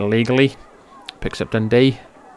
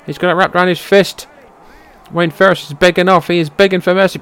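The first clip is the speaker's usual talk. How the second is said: higher, louder, exasperated play-by-play; worked-up commentary; 6 semitones higher, 3 dB louder than usual. Real, not acted. Commentator losing patience as the tag is missed, excited and exasperated. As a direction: keep calling it hot and frustrated.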